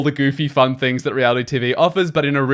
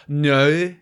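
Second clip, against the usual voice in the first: put-on Northern Irish accent. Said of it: "Irish drag queen would say it like".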